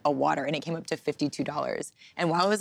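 ordinary talk

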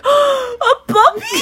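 high, slow, loud squeal with a laugh; thrilled shout, laughing; excited squeal. Retold or imitated. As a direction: shriek it like a kid getting a puppy.

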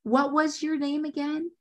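high-pitched, patronizing question; condescending. Retold or imitated. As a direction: mimic someone talking down to a nobody. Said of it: "like, so condescending".

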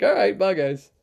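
loud, high-pitched mock-breezy goodbye; playfully nonchalant sign-off; cheeky farewell. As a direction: toss off a chirpy goodbye. Cheeky exit from angry opponent.

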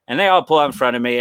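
usual speaking voice

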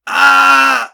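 sudden loud mock scream; retold scream. Retelling a kid's scream from the paint room, loud sudden yell. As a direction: burst out in a startled yell. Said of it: "hör jag ett skrik".

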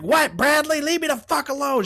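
high country mom voice. Retold or imitated.

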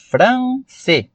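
"France" split as fran-cê; two-syllable misreading. Shown as a demonstration. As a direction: add a Portuguese second syllable to "France". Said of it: "ideia pra gente de dissílaba".

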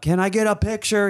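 high shouted call across the hall; shouted request. Retold or imitated. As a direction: call after him loudly. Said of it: "Yeah, I shouted".

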